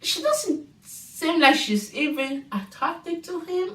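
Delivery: ordinary delivery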